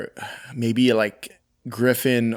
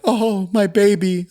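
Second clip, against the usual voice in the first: high, slow mock lament; mock swooning; 8 semitones higher than usual. Co-host mimicking the helpless love interest, swooning. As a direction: draw it out in a higher, put-on voice.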